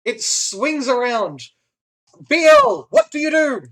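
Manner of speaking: loud, high combat call-out; dramatic game master. Acted. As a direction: throw the danger at the player, shouting. Game master announces combat turn.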